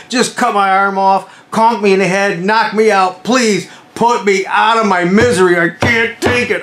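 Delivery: high, slow, drawn-out begging; acted desperate pleading. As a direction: beg for mercy in agony. Begs medic to end the pain.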